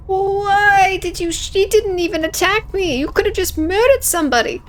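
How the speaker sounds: high-pitched, incredulous protest; acted indignant outburst. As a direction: start with a long drawn WHY, then protest high. Acted outburst after the shooting.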